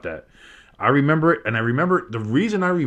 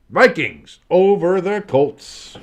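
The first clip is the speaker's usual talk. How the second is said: announcer score call; 8 semitones higher, 8 dB louder, slower than usual. Acted.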